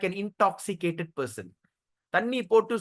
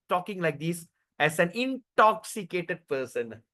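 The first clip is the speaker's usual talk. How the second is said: ordinary talk, nothing marked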